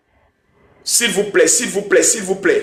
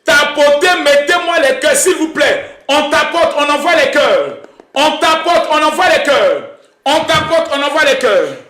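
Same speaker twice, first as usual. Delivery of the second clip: high-pitched rallying chant; hype call; 6 semitones higher than usual. Real, not acted. Host urging live viewers to tap and send hearts, hype call. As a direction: call out high, each phrase dropping at the end.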